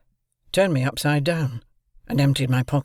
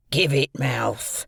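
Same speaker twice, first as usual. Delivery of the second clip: put-on loom over the boy and growl it; menacing convict; much slower than usual. A narrator's reading.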